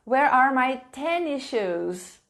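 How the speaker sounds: normal talking voice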